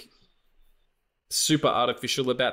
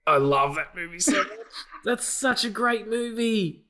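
slow, high drawn-out sloth voice; super slow sleepy sloth; 8 semitones higher than usual. Real, not acted. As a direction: drag every word out like a sloth. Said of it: "sloth from Zootopia".

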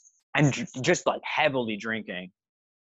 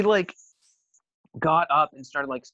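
normal talking voice

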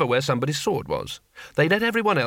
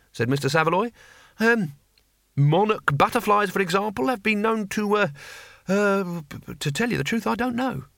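higher, halting, nervous teacher voice; flustered stammer; 5 semitones higher than usual. A narrator's reading. Teacher Saveloy fumbles an explanation to Cohen, nervous stammer. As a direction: stumble and search for the answer.

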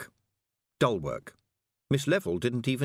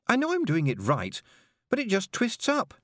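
high, frustrated protest; complaining; 11 semitones higher than usual. A narrator's reading. Pupil to her teacher, high frustrated complaint. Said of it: "Tiffany complained".

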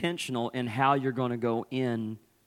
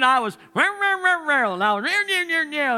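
mock growly rah rah, much higher and louder; angry grumbling; 13 semitones higher, 8 dB louder than usual. Pastor imitating his mother-in-law's fuming grumble, hammed-up. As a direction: ham up her fuming grumble. Wife fuming, picking a fight.

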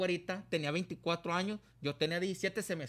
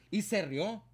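ordinary talk, nothing marked

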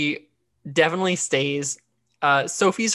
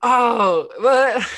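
real startled "oh, what?", higher and louder; surprised; 7 semitones higher, 6 dB louder than usual. Real, not acted. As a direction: caught off guard by the joke, laughing. Reacting to the Cockney joke.

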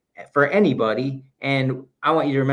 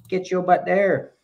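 higher, slowed, teasing command; playful order; 6 semitones higher than usual. Real, not acted. Host nudging listeners to volunteer, playful command. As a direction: push them out the door with a grin.

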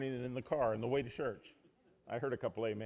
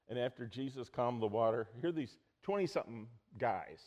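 usual speaking voice

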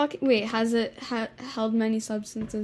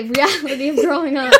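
breaking up into real laughter; laughing; 4 semitones higher, 10 dB louder than usual. Real, not acted. Hosts cracking up after an off-topic tangent, laughing. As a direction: laugh through the words.

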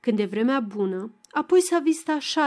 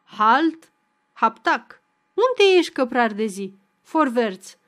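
delighted mock commands; 5 dB louder than usual. Tipsy Macedon calling for his corporal, louder gleeful barking. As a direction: play the drunk sergeant shouting his troops to attention. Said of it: "râde Macedon încântat".

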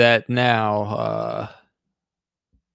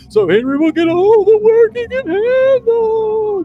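spooky voice; 12 semitones higher, 5 dB louder than usual. Pretend. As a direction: read it like a taunting ghost. Reads submission in spooky voice.

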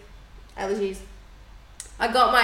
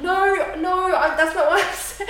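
higher, louder retold backpedalling; mock defensive protest, pitched up; flustered protest; 9 semitones higher, 5 dB louder than usual. Partner defending his suggestion to her, flustered protest.

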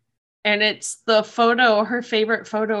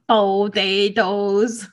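drawn-out movie quote; much slower than usual. Pretend. Lord of the Rings potato quote.

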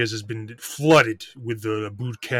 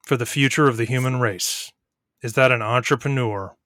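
ordinary talk, nothing marked

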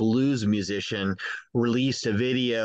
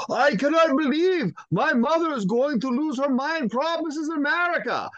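put-on high, thrilled German accent; excited German tourist meeting Krampus, thick German accent. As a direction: gush like an excited German dad. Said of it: "in this really thick German voice".

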